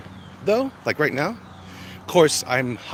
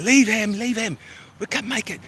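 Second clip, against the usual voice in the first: panicked plea; 12 semitones higher, 4 dB louder than usual. Retold or imitated. Panicked soldier in retold trailer.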